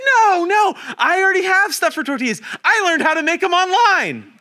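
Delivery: high, loud comic protest; insistent protest. Retold or imitated. Turning down a friend's tortilla mix, insistently protesting. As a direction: refuse the gift with overeager insistence. Acting out his refusal of the mix.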